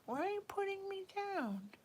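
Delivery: put-on high, small pleading baby voice; high, soft hurt baby plea; baby's voice. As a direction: voice the little one's hurt question.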